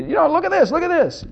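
high, eager insistence; imitated excited wife. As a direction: urge a sleepy husband to look. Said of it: "you just get all excited".

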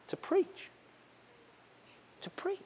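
near-whisper, very soft; barely voiced, solemn; hushed solemn call. Real, not acted. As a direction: drop to a whisper for weight.

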